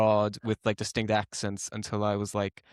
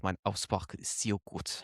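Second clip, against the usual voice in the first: German accent; 3 semitones lower, 9 dB quieter than usual. Shown as a demonstration. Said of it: "so I can do that a little bit".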